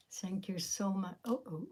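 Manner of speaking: ordinary talk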